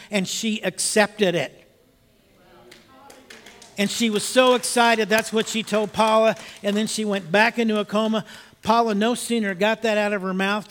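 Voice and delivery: rising sermon fervour; building preacher's telling. Acted. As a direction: lift the congregation with the story's turn. Preacher's story builds to a climax.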